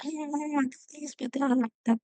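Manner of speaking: high, soft little whine of the ego; whiny ego voice. Retold or imitated. Voicing her own ego as a petty inner voice, high and soft. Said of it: "the one that is like".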